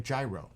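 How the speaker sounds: "gyro" with a j sound and long i; New York gyro. Shown as a demonstration. New Yorker giving an example, "gyro" as JAI-roh. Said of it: "as I'm pronouncing it".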